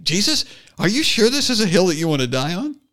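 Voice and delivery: high, fast, questioning lilt; mocking uptalk. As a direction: ask it like a doubtful whiny question. Mock quote with uptalk.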